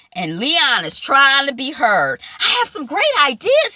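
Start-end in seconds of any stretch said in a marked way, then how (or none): 2.4-3.7 eager pitch. Retold or imitated. Leon trying to be heard.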